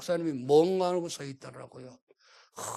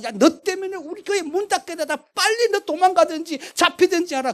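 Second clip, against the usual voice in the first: much higher, louder alarmed scolding; panicked scolding; 11 semitones higher, 9 dB louder than usual. Retold or imitated. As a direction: panic and push him out the door. Imagined panicked pastor.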